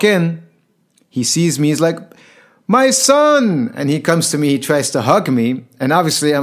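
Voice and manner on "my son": put-on much higher, joyful cry of an old father; excited old dad. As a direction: call out my son with delight. Dad's delighted greeting voiced.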